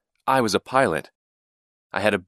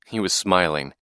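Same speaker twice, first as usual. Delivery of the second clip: ordinary delivery, nothing marked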